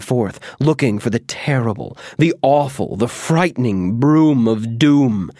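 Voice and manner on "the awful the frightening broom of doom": plain reading voice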